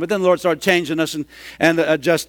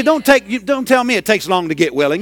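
normal talking voice

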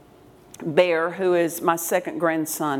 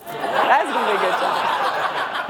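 amused, drawn out; slower than usual. Retold or imitated. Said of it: "I said".

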